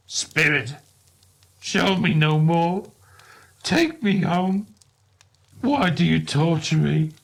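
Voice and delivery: a distressed man begging the ghost to stop; anguished plea. A narrator's reading. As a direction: plead to be taken home. Scrooge begs the spirit.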